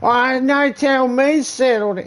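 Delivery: very high, loud mock drunk fury; mock drunk rounding on a sober mate who said settle down; escalating drunk. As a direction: get in his face, daring him.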